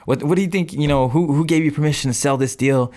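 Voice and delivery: confrontational outburst. Retold or imitated. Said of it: "come at you all crazy".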